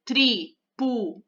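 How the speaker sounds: tripulantes split into tri, pu, lan, tes with pauses; syllable by syllable. Shown as a demonstration. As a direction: say tripulantes in four separate beats. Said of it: "quatro sílabas". (tripulantes separando as sílabas)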